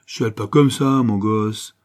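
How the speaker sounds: rough consoling from a street urchin; cocky street kid. Acted. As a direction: buck up the little one, loudly. Street kid consoles crying boy.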